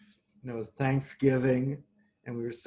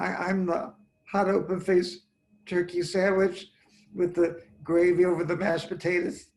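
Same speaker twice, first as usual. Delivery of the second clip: higher, louder eager confession of a favorite order; excited, louder reveal of a usual order; 6 semitones higher, 5 dB louder than usual. Retold or imitated. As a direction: blurt it out like a proud regular, higher and louder. Confessing his usual takeout order.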